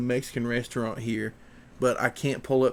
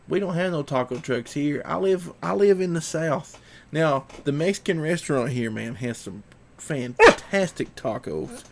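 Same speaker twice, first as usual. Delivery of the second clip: usual speaking voice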